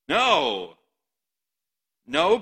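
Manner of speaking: loud, high, flat-out no; emphatic refusal. Acted. Peter refusing Jesus's warning, loud emphatic no. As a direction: slam the denial down hard. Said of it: "Peter declared emphatically".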